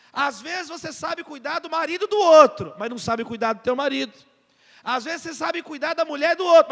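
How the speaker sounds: preaching. Acted. Preaching at full force.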